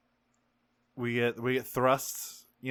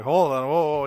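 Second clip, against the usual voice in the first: Andre the Giant; 2 semitones higher, 7 dB louder than usual. Retold or imitated. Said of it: "does the fucking Andre the Giant gif".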